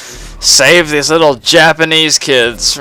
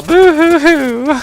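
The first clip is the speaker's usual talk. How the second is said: high, slow, hammed-up fake blubbering; mock boo-hoo sobbing; 14 semitones higher, slower than usual. Mocking himself for crying over grandma, hammed-up boo-hoo. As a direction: whine it out like a crybaby. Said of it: "me crying about this and being all".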